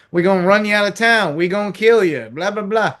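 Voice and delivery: quick, mock-threatening Southern townsman; Southern accent. Fan mimicking threats from a Southern thriller, Southern accent. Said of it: "Give me accents".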